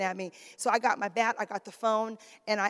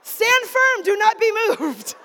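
higher, louder, slow declaration; preacher's rallying cry; preaching exhortation; 9 semitones higher, 8 dB louder, slower than usual. Acted. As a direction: proclaim it with full conviction.

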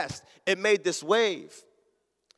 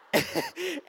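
high, softer helpless laughter; imitated cracking up; 10 semitones higher, 5 dB quieter than usual. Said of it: "he's cracking up laughing".

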